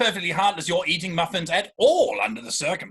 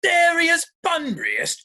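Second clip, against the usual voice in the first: shocked disbelief; 10 semitones higher, 4 dB louder, slower than usual. Acted. Jack aghast at Algy's claim.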